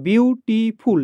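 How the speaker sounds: বিউটিফুল broken into three separate beats, beau-ti-ful; split into syllables. Shown as a demonstration. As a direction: sound out বিউটিফুল slowly, syllable by syllable. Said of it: "তিনটা syllable".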